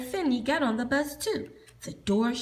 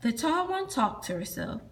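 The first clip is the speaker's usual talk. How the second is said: usual speaking voice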